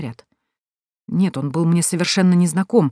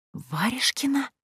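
breathless unvoiced whisper; 7 semitones higher, 8 dB quieter, slower than usual. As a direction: whisper the name in disbelief. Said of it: "прошептала я". (шёпотом)

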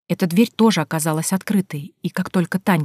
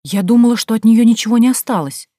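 higher, louder wonder; raised-voice amazement; amazed; 5 semitones higher, 6 dB louder than usual. A narrator's reading. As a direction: exclaim in astonishment at the find. Amazed friend in hidden church.

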